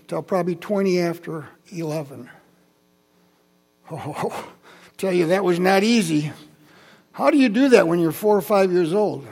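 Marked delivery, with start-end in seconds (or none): none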